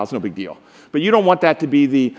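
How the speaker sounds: usual speaking voice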